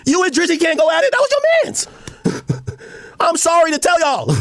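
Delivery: normal talking voice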